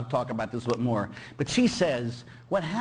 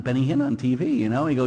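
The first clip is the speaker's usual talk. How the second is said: ordinary talk, nothing marked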